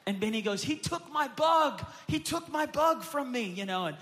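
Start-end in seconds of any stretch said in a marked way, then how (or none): 0.6-3.4 put-on high, louder, slow wailing kid voice; imitating a whining boy. Son complaining his bug was taken, high wailing. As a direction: wail it like a wronged little brother.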